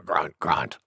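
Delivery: low, soft grunts; grunting boar. Acted. Wild Boar after her big sneeze, low grunting.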